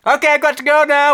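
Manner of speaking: high, loud put-on Alan Bennett voice; a shrill hammed-up impression of the departing guest. Guest leaving the radio show, in a high put-on voice. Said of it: "as Alan leaves".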